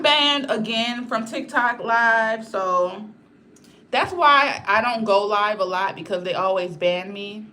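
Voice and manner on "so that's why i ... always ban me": ordinary talk, nothing marked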